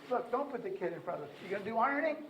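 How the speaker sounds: put-on lively parent talking a toddler through ironing; coaching parents. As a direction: urge the parent, then chat to the baby. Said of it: "what we say to parents is".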